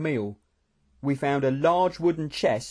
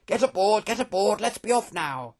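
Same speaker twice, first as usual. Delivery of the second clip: urgent, raised-pitch command; mild shout; 6 semitones higher than usual. A narrator's reading. General ordering men onto the wagon, mild shout. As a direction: hurry everyone on board. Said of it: "the general shouted".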